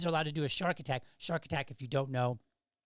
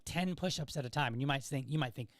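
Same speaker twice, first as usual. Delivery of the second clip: usual speaking voice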